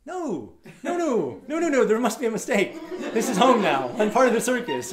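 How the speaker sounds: high, pleading comic refusal; hammed-up dismayed objection; mock protest. Acted protest against leaving circus.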